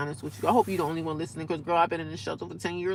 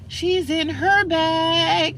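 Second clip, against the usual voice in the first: sassy; 9 semitones higher, 4 dB louder, much slower than usual. Pretend. Sassy aside about herself.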